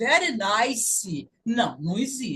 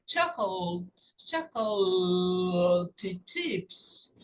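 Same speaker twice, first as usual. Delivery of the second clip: chocolate chips very slowly and lower, middle stretched; slow-motion demonstration; 4 semitones lower, 5 dB quieter, much slower than usual. Shown as a demonstration. Teacher breaks down chocolate for students, slow stretched syllables. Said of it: "a sílaba de baixo desce".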